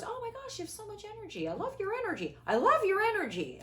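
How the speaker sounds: high, gushing imitation of an admirer; gushing fan. Host mimics admirers praising her energy, high and gushing. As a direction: gush over her energy like a starstruck fan. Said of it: "here are the themes that I've heard".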